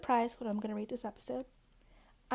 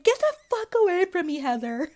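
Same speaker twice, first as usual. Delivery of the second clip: much louder and higher, snapping; mock furious yell; 8 semitones higher, 11 dB louder than usual. Imitating Lisa yelling at Heather to get away. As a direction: bark it at her to back off.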